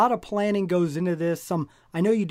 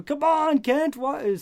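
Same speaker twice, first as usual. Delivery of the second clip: high-pitched mock yell at a phone; imitating a fan hollering at the screen; retold exasperated yelling; 8 semitones higher, slower than usual. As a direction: plead in frustration, pitch jumping up. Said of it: "yelling at my phone".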